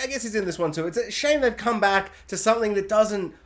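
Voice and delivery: usual speaking voice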